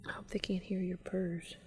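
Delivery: hushed whisper. Real, not acted. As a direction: whisper so the mic misses it. Whispering near recording cat.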